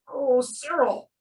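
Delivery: plain reading voice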